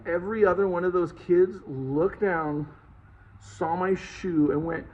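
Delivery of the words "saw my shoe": usual speaking voice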